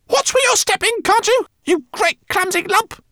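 very high squeaky rat yell; shrill squeak. A narrator's reading. Rat pilot yelling at the clumsy professor, shrill squeak. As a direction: squeal a warning like a tiny rat. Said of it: "squeaked Lola shrilly".

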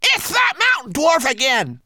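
high, drawn-out exclamation; startled Twigleg. A narrator's reading. Homunculus spotting the spying dwarf, startled cry. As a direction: cry out in alarmed recognition. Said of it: "cried the startled Twigleg".

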